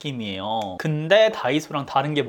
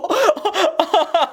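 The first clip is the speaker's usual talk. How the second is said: high squealing giggle; 13 semitones higher, 4 dB louder than usual. Real, not acted. Laughing at herself in the mirror, giggling. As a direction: let a giggle burst out.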